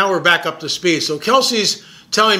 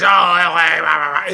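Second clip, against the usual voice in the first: lightly voiced dismissive skeptic; mocking naysayer. Mocking the naysayers who doubt the stone, lightly voiced scoff. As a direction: brush it off like a scoffing critic. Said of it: "the naysayers".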